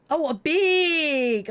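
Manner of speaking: sudden penny-drop exclaim; surprised on finding the misread word; surprised realisation. Real, not acted.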